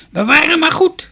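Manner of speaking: put-on high, loud, creaky old woman's voice; shrill-pitched storybook crone, full voice. A narrator's reading. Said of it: "riep een krakerige stem".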